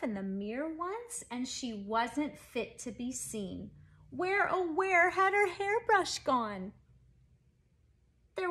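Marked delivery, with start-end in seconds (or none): none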